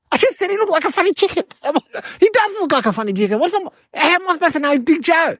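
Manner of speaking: high, rushed self-defence; indignant protest. Acted. Nephew defends himself to scared uncle, indignant protest. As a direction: blurt out excuses fast and high.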